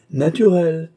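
"Naturelles" high and loud; triumphant word-finding. A narrator's reading. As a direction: land on "Naturelles" as the right word at last. King hits on the word. (« Naturelles » lancé haut et fort)

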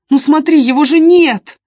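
high, loud, upset exclamation; agitated. A narrator's reading. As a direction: insist loudly that it's gone. Upset, showing the box is gone.

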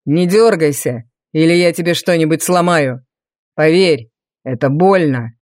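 low, menacing villain threat; threatening. A narrator's reading. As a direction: threaten her, low and cold. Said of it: "с угрозой добавил Валериан".

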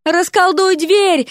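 high, stretched yell; high-pitched insistent bawl; yelling in his ear. A narrator's reading. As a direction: holler the order slowly. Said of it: "заорала Дереку на ухо Вася".

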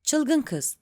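bored, impatient outburst; impatient grumble. A narrator's reading. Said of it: "canı sıkılanlara özgü bir sabırsızlıkla".

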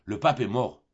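a touch of breathless urgency on pape; breathless announcement. Acted. Boy lying to his mother, mildly breathless and urgent. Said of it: "l'essoufflement d'une grande émotion".

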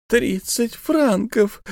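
very high, very slow plaintive cry; plaintive wail. A narrator's reading. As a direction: moan over the lost francs. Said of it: "раздался жалобный голос".

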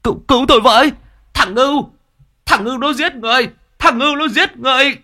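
high, panicked cry for help; screaming for help. Acted. Old man flailing in the pond screams that the boy is killing him. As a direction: shriek like a drowning man. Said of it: "ông Đại gào lên".

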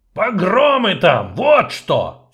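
sudden loud angry bark, slowed; furious bark. Acted. Dwarf barking at the sorceress in sudden fury. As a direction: snap it out in rage. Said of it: "со внезапной яростью рявкнул он".

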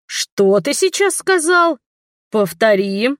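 slow, pitch jumping high, menacing; a mock-dangerous cobra-like challenge; nun threatening the old man, slowly. A narrator's reading. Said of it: "угрожающе прошипела она".

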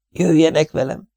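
high, small and pleading; pitiful plea. A narrator's reading. Mrs Tenios pleading with Poirot, pitiful. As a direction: beg them to come along. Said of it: "mondta szánalmasan Mrs Tenios".

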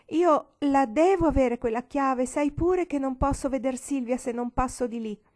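put-on girl pleading with grandmother, near tears; tearful pleading. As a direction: beg her, close to crying. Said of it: "con voce di pianto".

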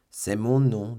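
usual speaking voice

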